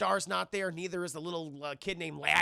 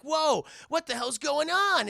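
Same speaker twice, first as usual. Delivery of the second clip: startled; 8 semitones higher, 5 dB louder, slower than usual. Retold or imitated. Family startled when lights return.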